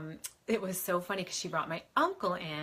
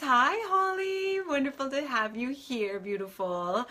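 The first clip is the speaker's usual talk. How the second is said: excited greeting; 6 semitones higher, 3 dB louder than usual. Real, not acted. Greeting Holly as she joins, excited high call. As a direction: sing out a delighted hi. Greeting a viewer joining live.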